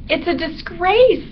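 high, slow lament; mortified high-pitched bat; scandalized little bat. A narrator's reading. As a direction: draw out the shame in a high bat voice. Bat laments his family's shame.

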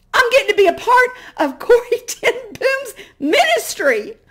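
high, loud, laughing through the words; laughing with joy. Real, not acted. Remembering her own thought outside Corrie's house, laughing. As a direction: bubble over with delighted laughter.

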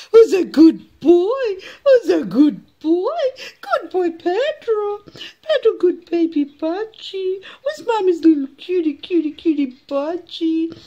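high, sing-song doting coo; loving baby talk. Shown as a demonstration. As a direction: gush over a pet bird in high baby talk. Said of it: "in a loving voice again".

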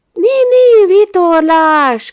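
high, loud cry of feigned discovery; surprised exclamation. A narrator's reading. Narrator pretending to spot a sailboat, surprised exclamation. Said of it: "kiáltottam föl".

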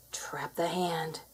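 slow, sly whisper. Shown as a demonstration. Narrator teasing the game, a slow sly whisper. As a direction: hush it like a trap closing.

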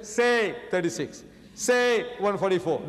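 slow, deliberate, leaning on say; wrongly added say. Shown as a demonstration. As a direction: spell it out with an odd extra say. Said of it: "Do you say like that?".

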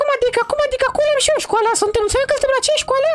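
put-on high falsetto girl voice; high put-on girl voice scolding her old friend. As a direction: play the offended girl in a high voice. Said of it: "trebuie să fac vocea".

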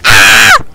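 low loud anguished shout; low groan yell. Real, not acted. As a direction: bellow in defeat. Scream on death.

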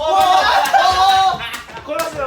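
startled loud cry of dismay; surprised yelp. Real, not acted. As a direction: yelp as the rope catches. Tripped on jump rope.

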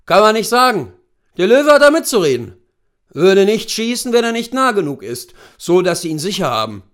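put-on brief the client as an old hunting guide; erfahrener Großwildjäger. Hunter instructing his client. (erfahrener Jagdführer, lauter und höher)

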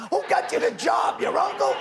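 high-pitched comic berating; mock scolding. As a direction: chew out an underling for laughs. Reenacts mock berating of staff.

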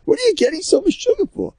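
exasperated retort in a storyteller's high voice; imitated yell back. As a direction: yell back as if death were no big deal.

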